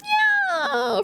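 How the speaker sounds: very high drawn-out yeah; high squeak. Real, not acted. Sudden squeak.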